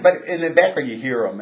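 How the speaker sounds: ordinary delivery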